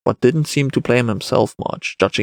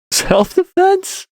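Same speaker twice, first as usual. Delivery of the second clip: put-on high, drawn-out incredulous boy; amused child; much slower than usual. A narrator's reading. Boy doubts man's self-defense, very high child voice. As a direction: stretch it out in a very high kid voice. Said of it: "Now the boy had to laugh".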